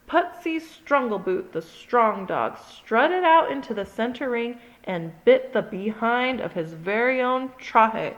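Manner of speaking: plain reading voice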